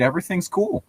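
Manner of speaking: ordinary delivery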